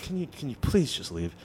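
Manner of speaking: soft, halting plea; meek imitation of a worn-down manager; nerdy manager. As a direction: plead quietly, restarting the question. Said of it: "kinda, like, nerdy".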